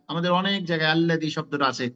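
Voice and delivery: normal talking voice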